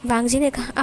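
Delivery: ordinary delivery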